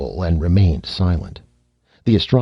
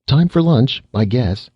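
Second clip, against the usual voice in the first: bright, high casual greeting; breezy, pitched well up; cheerful; 6 semitones higher, 3 dB louder than usual. Acted. Boy breezing in for lunch, cheerful. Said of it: "said cheerfully".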